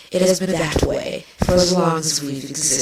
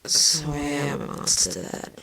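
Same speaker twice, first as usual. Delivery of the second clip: muttered; 8 dB quieter than usual. Acted. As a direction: grumble it half under your breath. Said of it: "Chrysalis muttered".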